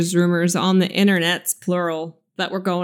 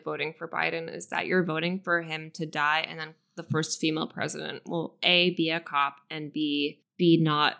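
normal talking voice

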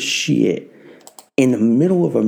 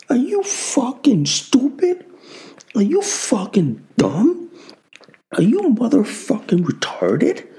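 furious mockery; 10 semitones higher than usual. Insulting rhetorical questions.

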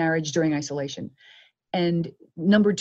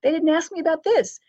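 dismayed realization; 10 semitones higher, 4 dB louder than usual. Retold or imitated. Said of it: "And you think".

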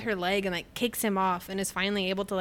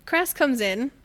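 ordinary delivery

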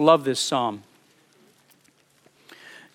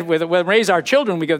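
normal talking voice

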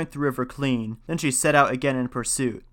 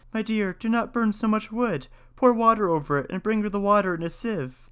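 put-on frightened girl begging; 7 semitones higher than usual. Frightened girl begs the maid.

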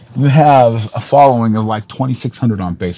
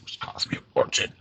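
much lower, much softer whisper; whispered aside; 11 semitones lower, 15 dB quieter than usual. Real, not acted. A muttered complaint about dental costs. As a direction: drop it under the breath.

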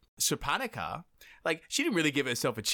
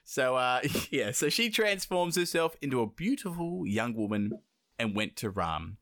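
normal talking voice

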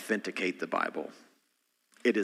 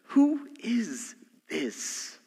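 awed disbelief; 10 semitones higher, much slower than usual. Acted. Disciples terrified asking each other.

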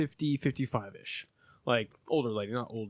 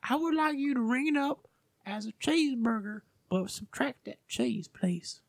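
high, particular customer impression; old lady customer; 8 semitones higher than usual. Old lady instructing the cashier, high mock customer voice. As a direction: spell out the order in a high put-on voice. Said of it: "This old lady comes up".

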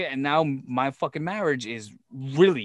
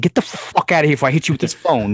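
retold angry threat; 6 dB louder than usual.

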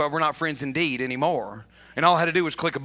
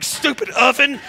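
mild mock anger; 7 semitones higher, 5 dB louder than usual. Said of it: "mad at the oven".

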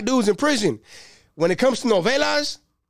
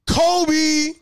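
high excited Kobe call; 9 semitones higher, 3 dB louder than usual. Retold or imitated. Comic retelling a trash can shot, higher excited call. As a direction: sing out the name like a shot.

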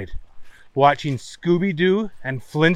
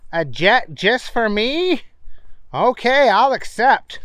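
a bit higher character voice; Booger voice; 7 semitones higher, slower than usual. Acted. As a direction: raise the voice for Booger.

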